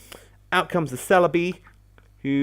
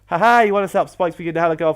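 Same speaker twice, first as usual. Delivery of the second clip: higher, louder mock gloat, punching each "ha ha"; gloating taunt; 4 semitones higher, 5 dB louder than usual. Voicing Skarmory mocking its opponent, gloating taunt. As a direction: crow it like an untouchable tank. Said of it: "just sit there and go".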